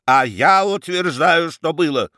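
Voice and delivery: громкий возмущённый возглас; выкрикнуть с вызовом, протестуя; воскликнул. A narrator's reading. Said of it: "воскликнул Рамкомф". (Рамкомпф восклицает, возражая барону: громко)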